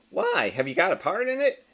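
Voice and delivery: very high why; a light nosy squeak; curious teammate. Acted. Teammate asks.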